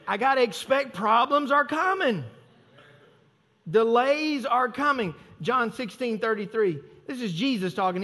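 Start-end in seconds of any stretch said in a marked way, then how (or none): none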